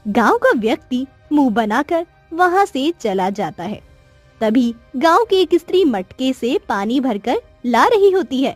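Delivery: usual speaking voice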